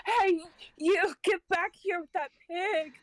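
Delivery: put-on drunken high yell after a thief; drunk shout. Drunk Pandora yells at the farmer with the pig. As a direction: slur a high drunken call after the man. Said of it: "She's like, drunk off her ass".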